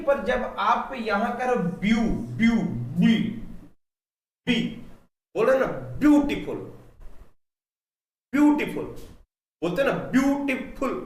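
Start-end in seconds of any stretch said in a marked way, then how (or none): 1.8-4.8 "view" and "बी" punched out loud, one at a time; stress on बी. Shown as a demonstration. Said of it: "तो बी में stress दे रहे हैं". 6.0-10.9 "beautiful" high and forceful on its opening syllables; syllable stress demo. Shown as a demonstration. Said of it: "beautiful को मैंने तीन टुकड़ों में तोड़ा".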